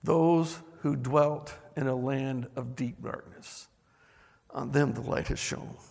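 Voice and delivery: slow, soft reading of the verse; hushed scripture reading. Acted. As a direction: read it gently, almost to yourself.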